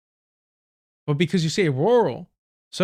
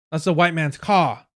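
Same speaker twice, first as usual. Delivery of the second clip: ordinary talk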